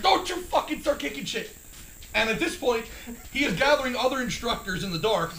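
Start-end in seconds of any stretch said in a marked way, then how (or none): 0.0-1.6 high-pitched mock telling-off; retold high scolding; exasperated instructor. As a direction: imitate the instructor's raised-voice order. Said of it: "The instructor".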